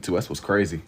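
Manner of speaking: ordinary delivery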